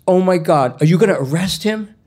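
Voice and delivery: put-on loud, high shocked kid; acted-out child's shocked cry; alarmed outburst. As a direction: burst out in high, loud disbelief.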